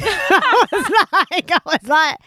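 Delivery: laughing. Real, not acted. Laughing while telling.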